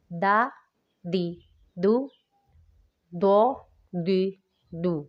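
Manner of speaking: دا light and thin, ض full and heavy. Shown as a demonstration. Teacher drilling د against ض for students, careful contrast. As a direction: keep د thin, fill out the ض. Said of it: "دال کو ہم لوگوں نے باریک پڑھنا ہے".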